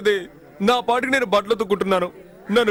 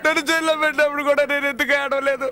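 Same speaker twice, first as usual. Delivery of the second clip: tearful complaint; 5 semitones higher than usual. Acted. Man cries over being called fraud.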